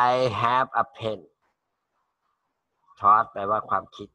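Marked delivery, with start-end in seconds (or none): 0.1-1.2 slow model sentence. Shown as a demonstration. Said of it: "teacher models sentence slowly".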